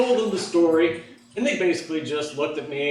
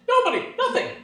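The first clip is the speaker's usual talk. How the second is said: very high, squeaky protest; high-pitched teenage mock denial; acted denial; faster than usual. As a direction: plead innocence way up high. Son answering parent's questioning.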